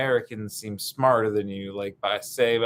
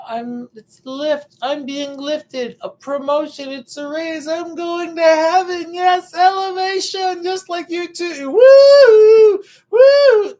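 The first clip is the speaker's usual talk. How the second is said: very high, squealing mock elation; elated elevator; 11 dB louder, slower than usual. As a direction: whoop with joy, voice way up high. Acting out the joyful elevator.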